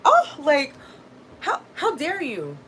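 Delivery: high, gasping mock outrage; hammed-up indignant disbelief; shocked, offended people. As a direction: imitate someone scandalized, stretched out slowly. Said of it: "People are like".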